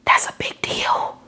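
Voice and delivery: hushed whisper of awe. Acted. Preacher whispers to her listeners in awe. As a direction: breathe it out in a whisper. Repeats line, first whispered.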